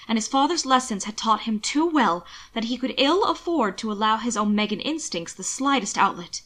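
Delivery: ordinary delivery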